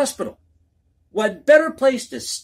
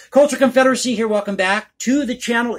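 loud channel greeting; 5 semitones higher, 5 dB louder than usual. Real, not acted.

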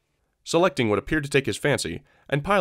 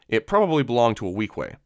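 ordinary delivery

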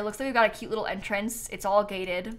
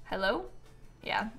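ordinary talk, nothing marked

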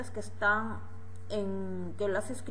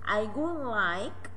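slow model phrase; much slower than usual. Shown as a demonstration. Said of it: "teacher models phrase".

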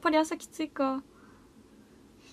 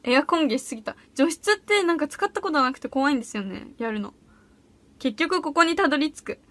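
ordinary delivery

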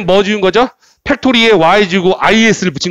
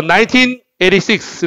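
a break between 나인틴 and 에이티 식스; 나인틴 에이티 식스 read as two separate chunks; split into two-digit groups. Shown as a demonstration. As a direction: say 나인틴, pause briefly, then 에이티 식스. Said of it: "두 개씩 끊어서 발음한다고 그랬죠?".

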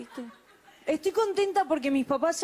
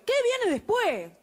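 higher, slow exasperated repeat; slow, raised, hammed-up outrage; mock outrage; 9 semitones higher, slower than usual. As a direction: throw it up high in mock alarm.

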